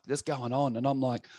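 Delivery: ordinary delivery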